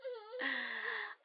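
high unhinged giggle; creepy giggle. Acted. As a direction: giggle with menace. Laughs at victim.

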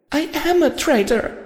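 high, far-off meek wail as Fluttershy; tearful pony wail. A narrator's reading. Fluttershy confesses to Rarity, high meek wail. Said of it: "Fluttershy wailed meekly".

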